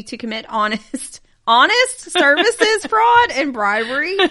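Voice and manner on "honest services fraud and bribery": high, laughing disbelief. Real, not acted. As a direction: laugh through the words. Laughs while reading charge.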